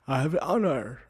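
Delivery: laughing, badly faked heroic accent; failed Thor accent. Trying for Thor, sounding like Apu, botched accent. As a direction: attempt a grand Thor accent and crack up. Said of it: "I sound like Apu from The Simpsons".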